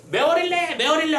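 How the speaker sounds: high-pitched mock birdcall; crying bird. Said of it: "하면서 소리를 질러요".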